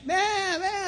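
high, soft mock bleating; panicked sheep bleat. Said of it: "the sheep begin to panic".

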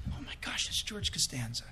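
awed whisper to himself about a celebrity. Retold or imitated. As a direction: whisper so the neighbour can't hear. Spotting a celebrity lookalike.

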